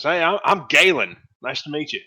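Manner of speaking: a touch loud and high introduction; friendly dwarf. Acted. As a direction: introduce yourself cheerfully. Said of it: "And he says".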